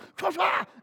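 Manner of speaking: high explosive spluttered noise; flailing kick noise. Acted. Ticklish preacher mimics lashing out, explosive noise. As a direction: burst out a sharp mock-fighting noise.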